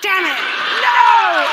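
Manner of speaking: a hammed-up cry of defeat; mock outburst. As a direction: throw your hands up and shout it in fake despair. Comic swearing outburst, child collects.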